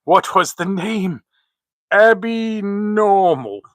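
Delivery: slow, high put-on comic voice; Igor impression. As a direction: drawl it out like a goofy henchman. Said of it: "young Frank".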